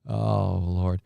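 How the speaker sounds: usual speaking voice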